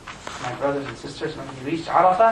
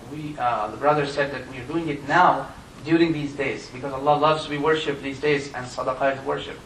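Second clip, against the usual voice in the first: ordinary delivery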